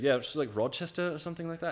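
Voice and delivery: high-pitched bad British impression; terrible British accent. Mimicking the guy who gave a ride, bad British accent. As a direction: put on a clumsy British accent. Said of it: "my terrible British accent".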